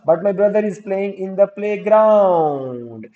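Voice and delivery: very slow, stretched teacher's dictation; slowly spoon-feed the example sentence; drawn-out dictation. Shown as a demonstration. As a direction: linger on playground, holding it long.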